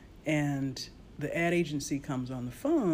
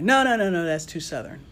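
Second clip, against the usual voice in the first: emphatic mock client; 2 semitones higher, 8 dB louder than usual. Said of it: "I come back".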